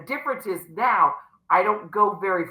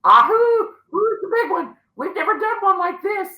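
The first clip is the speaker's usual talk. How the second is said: acted-out eager cheer; playful bravado; 13 semitones higher than usual. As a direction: whoop it up, pitched way up. Acts out eager prayer over contract.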